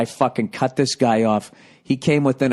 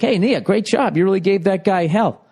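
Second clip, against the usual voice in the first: high, jokily congratulatory praise; mock-impressed high-pitched kudos; jokey; 10 semitones higher, 4 dB louder than usual. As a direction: cheer her on in a raised, high voice. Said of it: "I'm trying to be funny about it".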